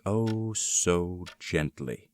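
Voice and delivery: very slow, savouring each word; slow hushed relish. Acted. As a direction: stretch it out as if still creeping. Describing opening the door.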